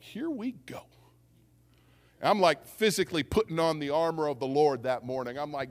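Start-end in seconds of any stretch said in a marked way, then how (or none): 0.0-0.8 high, breath-only whisper; acted hushed aside to oneself; whispered bracing. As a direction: whisper it as you brace for trouble. Bracing himself as confrontation starts.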